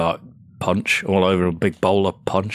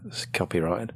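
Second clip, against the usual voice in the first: whispered aside; hushed joking whisper; 8 dB quieter than usual. Real, not acted.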